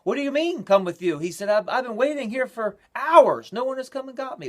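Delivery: loud, high indignant outburst; ticked off. Retold or imitated. Howard Storm protesting loudly to the beings who kept him waiting. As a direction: bristle with outrage at being left waiting. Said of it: "And he was ticked".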